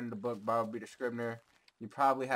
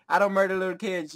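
higher, louder insistence; loud denial; 7 semitones higher, 8 dB louder than usual. Real, not acted. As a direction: insist loudly, backpedaling. Hasty denial after joke.

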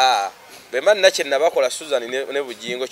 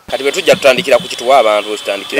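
louder and faster, pushing on; loud fast talk; 2 semitones lower, 10 dB louder, faster than usual. Real, not acted. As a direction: raise the voice and rush it. Heated exchange, raised voices.

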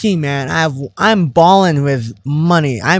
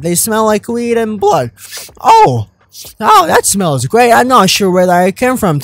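higher, louder chipper alien voice; friendly alien; 5 semitones higher, 4 dB louder than usual. Acted. Alien greeting the player and offering to join, higher and chipper. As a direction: greet the stranger as a cheerful alien.

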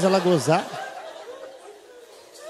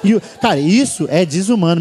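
ordinary talk

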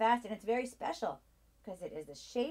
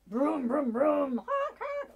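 loud playful vroom and honk; car noises; 5 semitones higher, 10 dB louder, much slower than usual. Acted. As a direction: make the toy cars roar. Playing car noises for kids.

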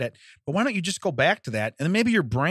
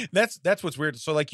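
usual speaking voice

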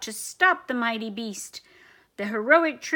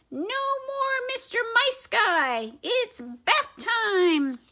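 much higher, scolding squeak; squeaky mouse voice; 10 semitones higher, slower than usual. Acted. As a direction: taunt the troops in a tiny voice. Commander confronts dog.

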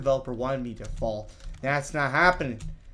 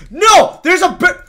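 high, very loud protest; indignant shout; 17 dB louder, slower than usual. Real, not acted. Gamer discovering a barrier block, indignant shout. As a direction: burst out in outraged protest.

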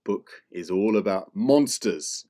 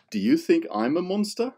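ordinary delivery

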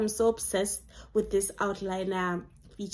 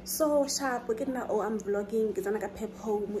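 ordinary talk, nothing marked

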